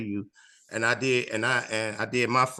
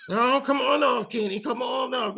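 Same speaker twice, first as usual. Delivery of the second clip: host ordering him off; 11 semitones higher than usual. Retold or imitated. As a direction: wave him off the stage like a fed-up host. Host pulls him off stage.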